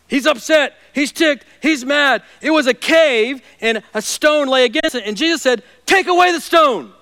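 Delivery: loud, high, driving sermon; fiery preaching. Acted. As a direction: preach it at full force. Preaching at full force.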